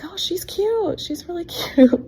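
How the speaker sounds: high, overly casual compliment with a laugh; feigned casual. Retold or imitated. Mother pretending not to know her own daughter, breezy and high. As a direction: act like she's just some kid.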